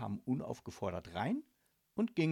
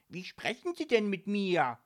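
high, louder offended voice; affronted, raised-voice challenge; annoyed; 8 semitones higher, 7 dB louder than usual. A narrator's reading. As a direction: bristle at the rudeness. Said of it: "fragte sie ärgerlich".

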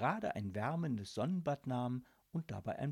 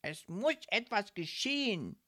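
resolute; 6 semitones higher than usual. Acted. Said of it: "sagte sie resolut".

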